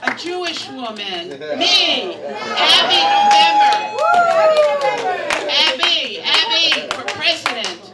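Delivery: loud high rally cry of her own name; campaign shout. Acted. Comic grandma rallying the crowd, shouting her slogan. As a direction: belt it out like a campaign chant.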